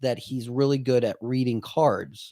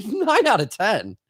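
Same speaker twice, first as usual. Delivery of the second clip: incredulous; 7 semitones higher, slower than usual. Retold or imitated. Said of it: "I'm like".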